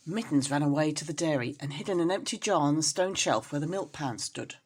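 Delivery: usual speaking voice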